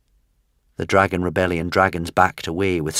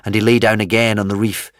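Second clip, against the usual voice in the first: ordinary delivery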